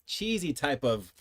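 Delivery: normal talking voice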